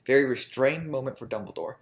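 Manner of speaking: usual speaking voice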